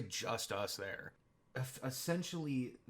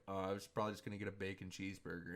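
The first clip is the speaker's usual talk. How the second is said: hurried mumble; 3 semitones lower, 6 dB quieter, much faster than usual. Retold or imitated. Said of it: "And he's like".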